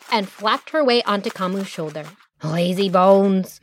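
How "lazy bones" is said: slow, teasing drawl. Acted. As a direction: tease him fondly. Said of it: "he teased".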